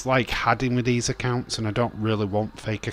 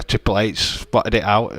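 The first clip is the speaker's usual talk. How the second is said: ordinary talk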